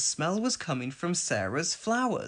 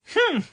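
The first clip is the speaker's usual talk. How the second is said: indignant snort; 8 dB louder than usual. A narrator's reading. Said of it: "snorted Elvis".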